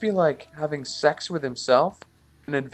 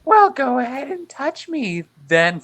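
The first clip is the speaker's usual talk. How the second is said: put-on loud, high falsetto girl; badly faked girl, pitched way up; 12 semitones higher, 6 dB louder than usual. Persona inviting her summoner, high falsetto girl voice. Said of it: "Is that really your best girl voice?".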